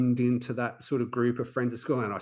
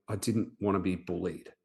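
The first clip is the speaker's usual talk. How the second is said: normal talking voice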